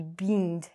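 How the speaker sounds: "beamed" as "beend", long E in place of bend; wrong long E. Shown as a demonstration. Teacher jokes it sounds like New Zealand, long E. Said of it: "sounds like I'm from New Zealand".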